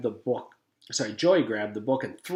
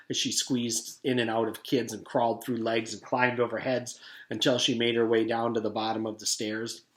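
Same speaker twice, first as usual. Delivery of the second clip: ordinary delivery, nothing marked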